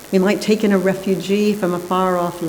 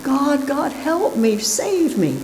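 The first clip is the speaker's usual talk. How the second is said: much higher desperate cry to God; pleading; 10 semitones higher, slower than usual. A narrator's reading. Man on the roof pleading with God, high desperate plea. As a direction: beg from the rooftop. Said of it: "pleading with God".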